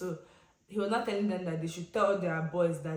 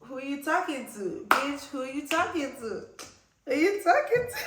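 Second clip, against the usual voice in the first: sassy comeback; 7 semitones higher, 5 dB louder than usual. Retold or imitated. Snapping back at the driver, high sassy comeback. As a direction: talk back with Gen Z attitude. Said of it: "I'm looking like".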